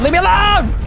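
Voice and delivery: high, loud frantic yelling; panicked shouting. Real, not acted. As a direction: shriek for help while running. Monster chasing him.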